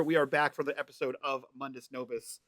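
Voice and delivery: normal talking voice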